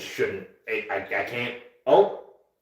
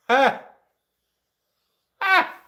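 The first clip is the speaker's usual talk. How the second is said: mocking laugh; 8 dB louder than usual. Real, not acted. Bitter laugh at retirement.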